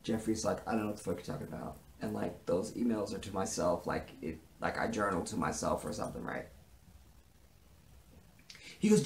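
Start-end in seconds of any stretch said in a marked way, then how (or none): 0.7-6.5 low, soft, offhand excuse; evasive denial. Acted. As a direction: play it down in a low murmur. Jeffrey's acted evasive answer to Justin.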